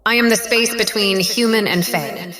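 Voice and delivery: loud, proclaiming power; triumphant declaration. Acted. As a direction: announce your new self to the world.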